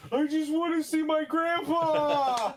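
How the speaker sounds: high, slow, wailing mock sob; hammed-up tears for grandpa; fake tearful plea. Character fake crying at the guard to see grandpa. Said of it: "my best sad face and with tears".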